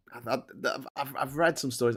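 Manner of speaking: usual speaking voice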